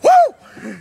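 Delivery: high yelp from the cold; shocked woo scream. Real, not acted. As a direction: whoop out the icy shock. Shaking off the ice water.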